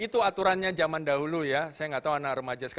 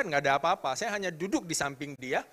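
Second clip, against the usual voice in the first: usual speaking voice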